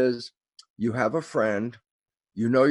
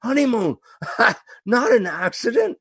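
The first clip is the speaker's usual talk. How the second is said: excited laugh; 10 semitones higher, 4 dB louder than usual. Real, not acted. Triumphant punchline with a laugh.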